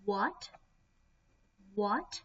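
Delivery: WHAT rising like a question; second tone. Shown as a demonstration. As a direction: lift WHAT sharply upward. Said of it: "It's the second tone".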